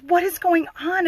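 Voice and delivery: high-pitched urgent question; retold demand. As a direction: imitate a nurse demanding answers. Head nurse demanding an explanation.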